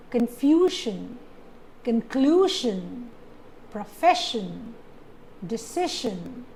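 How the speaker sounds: slow word list: confusion on FU, conclusion on CLU, profession on FES, decision on CI; second-last syllable stressed. Shown as a demonstration. Teacher lists examples, stressing confusion, conclusion, profession, decision second from last. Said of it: "stress is on the second last syllable".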